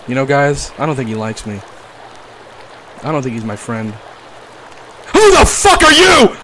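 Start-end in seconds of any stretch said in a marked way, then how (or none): 5.1-6.3 high, loud, slow startled cry; startled shout. Real, not acted. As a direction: yelp it at the sudden stranger. Sudden monster appears.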